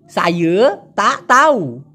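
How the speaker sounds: সাইয়ে তা তাউ drawn out slowly, vowels slurred together; colloquial Malay. Shown as a demonstration. Teacher imitating how Malaysians actually say it, slowly. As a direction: slur সাইয়ে তা তাউ the way locals do. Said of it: "ওরা সাধারণত ল্যাটিনটা বলে".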